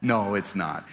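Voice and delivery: ordinary delivery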